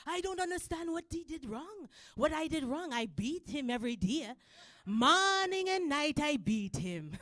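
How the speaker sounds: imitating a Jamaican dad; puzzled complaint in a Jamaican accent. Dad baffled by his son's behavior, Jamaican accent. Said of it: "This is Jamaican accent".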